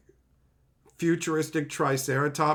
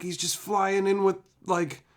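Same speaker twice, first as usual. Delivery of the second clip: ordinary talk, nothing marked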